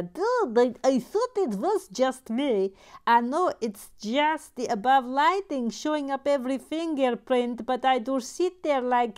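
animated chat read. Acted. Reading a chat message aloud.

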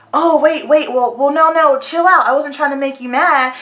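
mock a hasty, high-pitched retreat; imitated backpedaling. People backing off once she gets agitated, mock placating. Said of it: "they wanna say".

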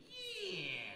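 mock enthusiastic Aussie yes; bright, high imitated yell of agreement; excited yeah. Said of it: "And they said".

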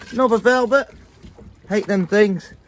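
ordinary talk, nothing marked

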